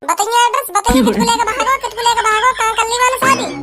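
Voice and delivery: high-pitched, insistent questioning; worked-up demand. Acted. Son demanding the eloped teacher's address, worked-up demand.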